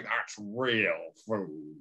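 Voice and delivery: slow put-on American southern drawl. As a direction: stretch each word like a mock Southerner. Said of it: "like, the southern drawl of a voice".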